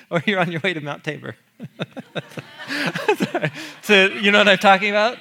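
amused and laughing at the memory. Real, not acted. Laughs recalling the costumed players.

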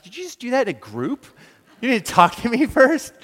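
high, indignant "did you just do that"; retold shocked protest; indignant disbelief.

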